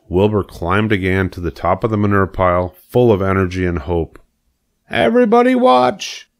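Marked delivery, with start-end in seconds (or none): 4.9-6.3 high, loud call to all; excited cry. Acted. Wilbur on the manure pile calling everyone, high and loud. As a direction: announce the big jump. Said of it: "he cried".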